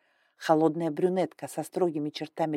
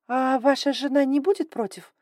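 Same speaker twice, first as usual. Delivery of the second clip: put-on higher, timid girl's question; cautious young woman; 7 semitones higher, 4 dB louder than usual. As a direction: ask carefully, a bit higher. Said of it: "осторожно спросила девушка".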